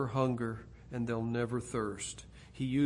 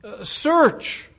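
higher and louder preaching; rising preaching; 7 semitones higher, 8 dB louder than usual. Real, not acted. Preacher rising in fervour toward the close. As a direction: lift it again toward the close.

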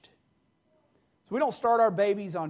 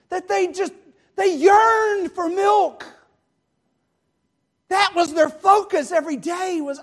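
loud, high, impassioned sermon cry; fervent preaching; 8 semitones higher, 11 dB louder than usual. Real, not acted. Preacher voicing the early church's hunger for God, fervent. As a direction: cry it out with stretched, yearning emphasis.

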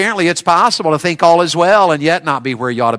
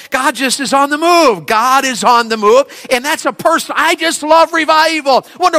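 fervent preaching; 10 semitones higher than usual. Real, not acted. Preacher rising fervour.

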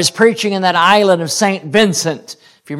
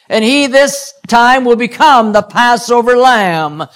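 higher, louder preaching with slowed emphatic lines; fervent preaching; 5 semitones higher, 3 dB louder than usual. Acted. Preacher proclaiming Jesus heading to the cross, fervent. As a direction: preach it out with rising fervour. Preaching builds to full force.

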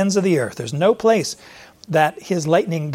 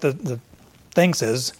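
ordinary talk, nothing marked

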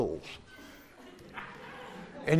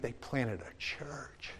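slow breathy whisper; 16 dB quieter, much slower than usual. Real, not acted. Preacher hushing the congregation on a point, whisper. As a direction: let it fall to a hush. Sermon climax hushed.

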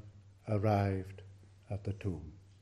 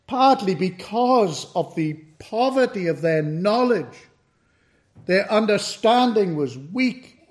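higher, louder preaching climax; fervent preaching; 8 semitones higher, 11 dB louder than usual. Acted. Preacher urges the congregation about the women's attachment, at full force. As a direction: drive it home from the pulpit.

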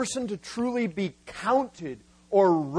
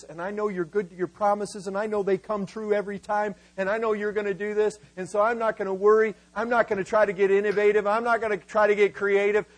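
rattled-off trusting reply; faster than usual. Acted. Imagined Abraham assuring God of his trust, rattled off fast. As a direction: reel off the assurances without a breath.

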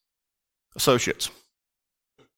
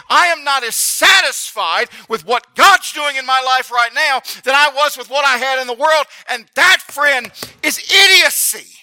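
high, impassioned sermon building louder; full-force preaching; 9 semitones higher, 5 dB louder than usual. Real, not acted. Preacher rebuking the congregation for missing Egypt, impassioned and building. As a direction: preach it hard, climbing to a loud peak.

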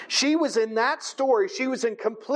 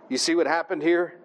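normal talking voice

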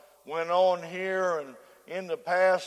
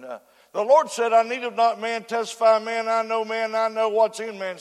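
prayer; 5 dB louder than usual. Acted. Closing prayer before preaching.

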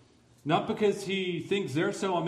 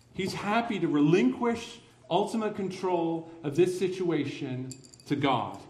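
usual speaking voice

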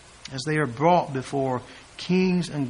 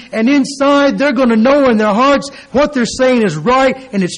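louder, higher fervent preaching; building preaching; 5 semitones higher, 7 dB louder than usual. Acted. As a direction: drive the point home to the listeners. Preaching rising intensity.

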